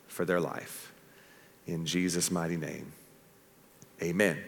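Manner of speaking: hushed, low close of a pastor's prayer. Real, not acted. Leads the congregation in prayer.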